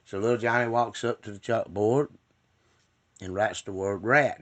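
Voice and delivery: ordinary delivery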